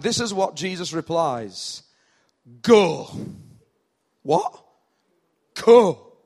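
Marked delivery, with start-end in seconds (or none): 2.6-3.1 loud one-word command. Acted. Jesus' curt reply, acted out. 4.2-4.6 high rising question; baffled, pitched right up; incredulous. Acted. Official reacts to Jesus' reply. 5.6-6.1 very loud, firm order; commanding. Acted. Jesus repeats the command.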